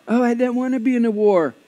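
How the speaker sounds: high mock-complaining voice; reluctant whiner. Church member objects to being in a war, mock whine.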